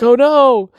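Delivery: loud, high mock wail; hammed-up cry of alarm; dismayed cry. Boy dropping his key in a bump, dismayed.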